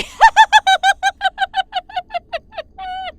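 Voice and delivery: long, loud, high maniacal laugh; maniacal laughter. Acted. Master relishing his key to ultimate victory, loud maniacal laughter. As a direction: throw your head back and laugh like a mad tyrant.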